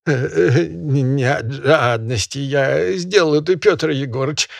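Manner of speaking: high, quavering peasant pleading; a hint of a trembling plea to the master; trembling excuse. Peasant excusing himself to the barin, high and quavering. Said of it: "оправдывается дрожащим голосом Хромой".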